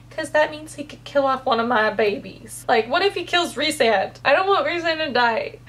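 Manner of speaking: normal talking voice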